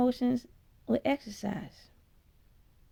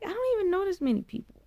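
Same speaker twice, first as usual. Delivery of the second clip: baffled; 11 semitones higher, much faster than usual. Retold or imitated. Retelling panicked confusion.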